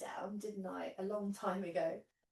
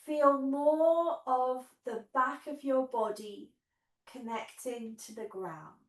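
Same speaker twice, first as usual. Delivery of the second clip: guided meditation. Acted. Leads guided relaxation on mat.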